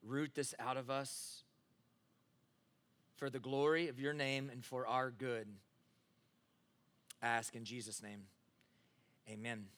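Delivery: soft, low closing prayer. Acted. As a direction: pray quietly to the Lord.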